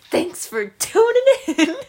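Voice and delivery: loud group sign-off. Pretend. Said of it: "Everybody say that".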